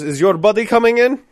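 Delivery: put-on high, loud question in foreign accent; Farhad's foreign accent. Manager asking about missing buddy, foreign accent. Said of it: "he goes".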